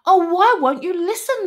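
loud, high frustrated plea; exasperated call. A narrator's reading. Ignored Numbat to the arguing animals, exasperated shout. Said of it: "the Numbat called out".

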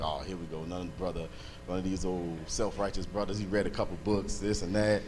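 low, quiet sceptical aside; dismissive inner grumble. Retold or imitated. Man recalling doubts about another self-righteous preacher, low dismissive grumble. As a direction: dismiss him under your breath.

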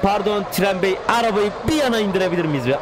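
high, mock-polite plea to the train; mock politeness. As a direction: play at courteous pleading.

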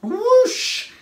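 very high, loud "whoosh"; whooshing sound word. A narrator's reading. Narrator as Craig shoots from the bottle, whooshing. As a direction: let it burst out suddenly.

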